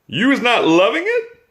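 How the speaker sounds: high, sweetly innocent question; innocent giant. A narrator's reading. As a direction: play dumb with wide-eyed sweetness. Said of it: "said the B F G innocently".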